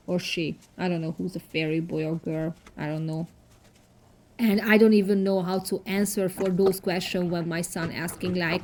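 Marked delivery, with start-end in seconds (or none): none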